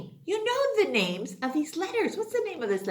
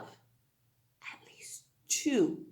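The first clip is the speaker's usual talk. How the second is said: slow whispered aside; 5 semitones lower, 8 dB quieter, much slower than usual. Real, not acted. Teacher answering her own question, whispered. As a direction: breathe it out quietly.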